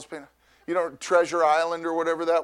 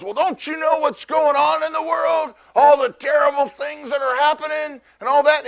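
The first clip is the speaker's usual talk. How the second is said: higher, louder scolding stranger; mock negative shout; 8 semitones higher, 6 dB louder than usual. Stranger shouts at the happy man about the world's troubles. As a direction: yell it like a doom-monger.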